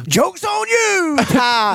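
high, drawn-out taunting call; playful, sing-out jeer; mock gloating. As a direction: crow it slowly at an absent friend. Mock gloating at absent friend.

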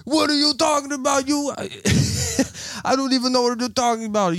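very high put-on female voice; high-pitched disguise. Playing innocent to the ranting scammer, high-pitched put-on voice. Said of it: "a little high pitched".

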